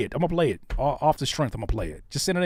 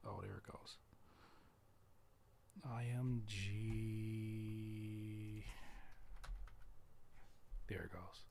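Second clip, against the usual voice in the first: whispering; 23 dB quieter, much slower than usual. Real, not acted. Muttering to self at computer.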